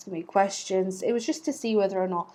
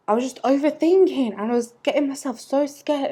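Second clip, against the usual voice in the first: higher and louder, anxious rush; rising panic; 7 semitones higher, 6 dB louder than usual. Real, not acted. Vlogger reliving her panic at the interview: anxious, raised. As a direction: let the worry spill out.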